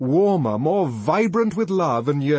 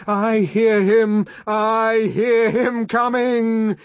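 high, loud, slow rhythmic chant; shouting in unison; 10 semitones higher, 7 dB louder, slower than usual. A narrator's reading. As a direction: chant it out on the beat, drawn out. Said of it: "shouting in unison".

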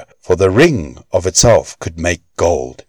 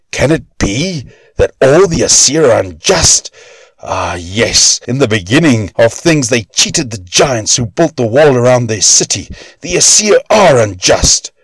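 higher, strained voice of accusation; bitter dwarf; 5 semitones higher than usual. A narrator's reading. Dwarf accusing the Aesir, high and bitter. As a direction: let the grievance swell to a cry.

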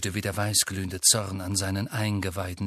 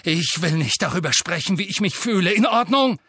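rising, louder angry outburst; shaking with anger; 7 semitones higher, 5 dB louder than usual. A narrator's reading. Harry, shaking with rage, refuses to talk about feelings. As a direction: hold the fury, then snap at the end. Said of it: "Bebend vor Wut".

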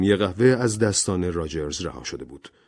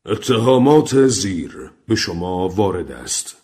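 slow, loud formal pronouncement; accusing recorded voice; 5 dB louder, slower than usual. Acted. Gramophone voice reads out the accusations, solemn and loud. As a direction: announce the charges loudly, stretching each word. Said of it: "دوباره آن صدا را شنید".

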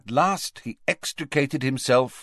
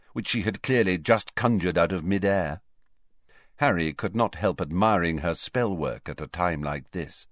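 ordinary delivery

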